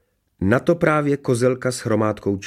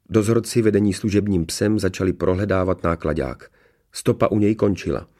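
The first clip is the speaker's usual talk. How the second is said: usual speaking voice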